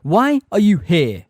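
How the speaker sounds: very loud, slow, high challenge; bellowing demand. A narrator's reading. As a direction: bellow each word separately at a trespasser. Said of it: "bellowed the hard-faced grey centaur".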